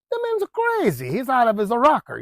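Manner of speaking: high-pitched mock disbelief; incredulous buyer. Buyer hearing the odd nail condition, mock incredulous. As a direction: start way up high, then come down.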